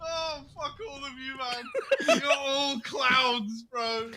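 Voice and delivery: laughing. Real, not acted. Mock outrage at friends' jokes.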